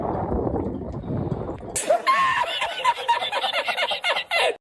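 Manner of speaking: high shrieking laugh. Real, not acted. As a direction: burst into a screaming laugh. Falls on trampoline.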